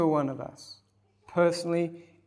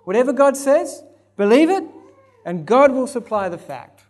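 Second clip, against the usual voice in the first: louder and higher, pressing; urgent exhortation; 6 semitones higher, 7 dB louder than usual. Acted. Preacher urging the congregation, louder and higher. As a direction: urge them to believe.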